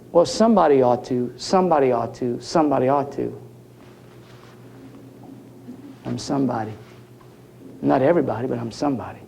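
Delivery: low, soft, earnest musing; hushed, sincere conviction; quiet reflection. Real, not acted. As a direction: drop right down and say it humbly to yourself. Quiet reflection after the joke.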